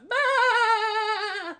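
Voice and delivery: very high, loud cartoon squawk of a laugh; SpongeBob laugh. Shown as a demonstration. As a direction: burst out with a shrill SpongeBob-style cackle. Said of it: "I can do the SpongeBob laugh".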